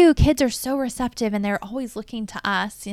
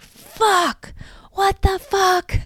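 shocked swearing; 10 semitones higher, much slower than usual. Retold or imitated. Retelling her reaction to husband's call, high shocked swearing. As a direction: swear it out like bad news just landed. Retold reaction to legal notice.

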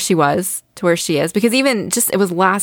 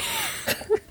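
high giggle; 11 dB quieter than usual. Real, not acted. Laughs at own nerdiness.

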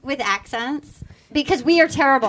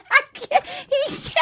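high, breaking into laughter; laughing; 11 semitones higher, slower than usual. Real, not acted. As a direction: laugh through the words. Laughing too hard to speak.

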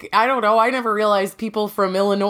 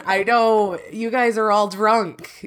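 usual speaking voice

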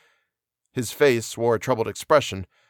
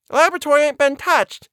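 very high, louder flustered housekeeper; breathless exclamation; 5 dB louder than usual. A narrator's reading. Housekeeper panting upstairs behind the doctor, breathless exclamation. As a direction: exclaim it in a high, agitated put-on voice. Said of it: "she exclaimed breathlessly".

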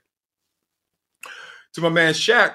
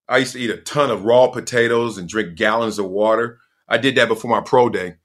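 normal talking voice

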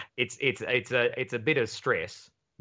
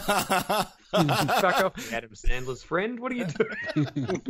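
mock outraged self; 8 semitones higher, much slower than usual. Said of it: "this moment of being like".

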